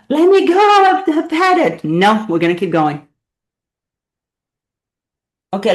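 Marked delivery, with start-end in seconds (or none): 0.1-1.8 high, loud mock plea; pleading muscles. Coach voicing the shaking muscles, a high loud mock plea. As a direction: voice the tired muscles begging to quit. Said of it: "They're like".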